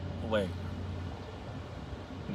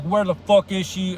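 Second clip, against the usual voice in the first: higher, louder retold angry demand; pissed; 6 semitones higher, 5 dB louder than usual. Dimitri grabs Fenya by the throat, mock pissed demand. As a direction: imitate a pissed man demanding answers. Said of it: "like, pissed".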